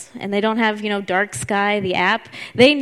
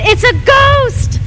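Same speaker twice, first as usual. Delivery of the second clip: high, drawn-out mock scream of terror; fearful cry; 13 semitones higher, 3 dB louder, slower than usual. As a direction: cry out like terrified disciples. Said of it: "They cried out in fear".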